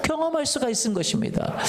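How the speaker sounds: usual speaking voice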